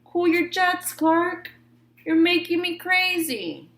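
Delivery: lightly voiced fish pal, fed up; Joey Mackerel. A narrator's reading. Best friend telling Clark to calm down. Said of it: "his best friend Joey Mackerel said".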